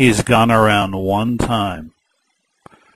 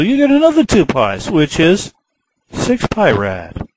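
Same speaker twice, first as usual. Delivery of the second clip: excited; 6 semitones higher than usual. Acted. Teacher excited about more pies.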